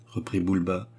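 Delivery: ordinary delivery, nothing marked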